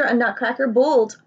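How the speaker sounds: usual speaking voice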